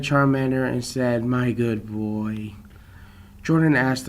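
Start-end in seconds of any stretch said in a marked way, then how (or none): 1.2-2.5 tender praise. Acted. Patting Charmander.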